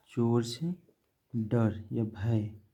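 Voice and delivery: each word drawn out for copying; slow dictation. Shown as a demonstration. Teacher to class, dictating very slowly. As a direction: say it very slowly so pupils can write. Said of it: "teacher dictates meaning slowly".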